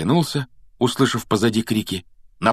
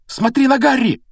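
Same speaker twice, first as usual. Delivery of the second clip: high-pitched excited cry; sudden exclamation; 4 dB louder than usual. A narrator's reading. Hermione tells Ron to watch Harry, excited cry. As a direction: urge him to look, voice shooting up. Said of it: "вдруг воскликнула Гермиона".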